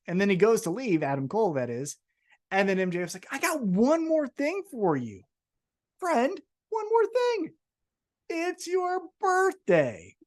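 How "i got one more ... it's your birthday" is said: high, drawn-out mock ring announcement; birthday announcement. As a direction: imitate a wrestler stretching out a big reveal, pitched way up. Acting out wrestler's birthday announcement.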